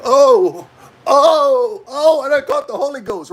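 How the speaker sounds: high, ecstatic church lady cries; church lady rapture. Retold or imitated. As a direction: wail like the spirit just hit her. Old lady catching Holy Ghost.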